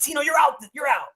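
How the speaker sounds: high, shouted mock dismissal; mock firing shout. Firing Tino from his commercial, mock-shouted. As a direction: bark him out of the role.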